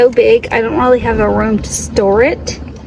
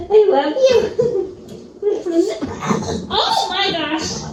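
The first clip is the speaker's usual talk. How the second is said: higher, laughing through words; laughing pet talk; 6 semitones higher than usual. Real, not acted. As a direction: laugh as you coo at the dog. Dog bumped her nose.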